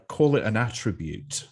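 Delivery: ordinary talk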